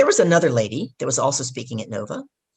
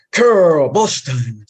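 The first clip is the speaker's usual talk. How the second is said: loud, long-drawn booming call; 7 dB louder, much slower than usual. Retold or imitated. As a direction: boom the name out slowly across a room. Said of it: "this man with this booming voice".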